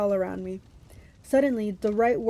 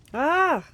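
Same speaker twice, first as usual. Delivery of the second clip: loud pirate bellow; 6 semitones higher, 4 dB louder than usual. A narrator's reading. Blackbeard waking as a man, bellowing. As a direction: let out a big roar. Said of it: "bellowed the big man".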